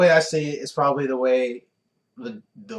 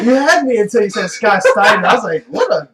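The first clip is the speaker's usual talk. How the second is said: louder, higher excited outburst; 7 semitones higher, 9 dB louder than usual. Real, not acted. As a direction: blurt it out in disbelief. Excited reaction to Steiner.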